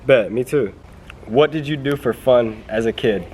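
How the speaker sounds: ordinary delivery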